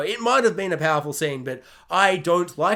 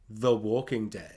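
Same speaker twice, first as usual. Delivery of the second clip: much lower, slow, soft dramatic voice; ominous title drop; 11 semitones lower, 9 dB quieter, slower than usual. Shown as a demonstration. Punchline title drop in a much lower, slow trailer voice. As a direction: intone the show's title like a trailer. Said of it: "One might say I'm".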